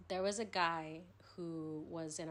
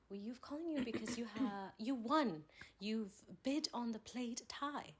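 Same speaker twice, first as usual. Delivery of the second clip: higher-pitched mock English accent; 5 semitones higher than usual. Fake eBay caller telling him he won, English accent. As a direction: announce it in a put-on English accent. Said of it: "do the best English accent".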